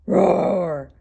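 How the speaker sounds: loud voiced mock roar; lion's roar. A narrator's reading. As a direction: roar out loud like a storybook lion. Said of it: "the lion jumped up".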